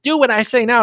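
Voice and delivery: high, loud imitation of an attacker; exaggerated pushy demand; mock bossy order. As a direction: bellow a command in a hammed-up voice. Voices attacker repeating the order.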